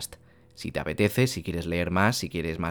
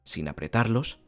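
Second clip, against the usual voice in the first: guided meditation. Acted. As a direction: ease the listener into stillness.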